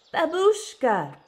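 put-on boy calling his grandmother with joy; joyful cry. A narrator's reading. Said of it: "Victor cried".

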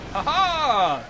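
loud, high mock laugh; triumphant "ha ha". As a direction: crow a drawn-out pirate-style "ha-ha". Own triumphant cry in play.

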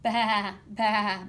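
loud, drawn-out baa; sheep bleating. A narrator's reading. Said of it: "bleated Sheep".

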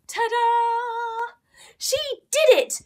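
high cheerful ta-da; triumphant. A narrator's reading. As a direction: celebrate the win. Successful trick.

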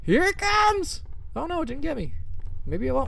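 high, jumpy fear, then relief; frightened squeals. Real, not acted. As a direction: squeak as it might come round the corner. Monster may appear any second.

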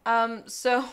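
ordinary delivery

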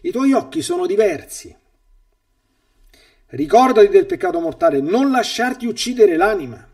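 a priest quoting a worried mother, pleading. Retold or imitated. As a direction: voice the anxious mother begging her daughter. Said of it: "me lo fece subito notare dicendomi".

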